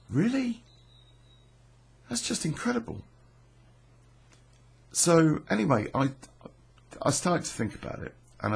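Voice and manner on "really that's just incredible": much higher retold fascination; amazed. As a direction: lift it up, hooked by the story. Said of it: "And I'm like".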